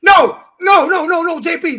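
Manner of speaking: insistent shouted no; shouting over him. Real, not acted. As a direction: cut him off with a yell. Heated argument, cutting in.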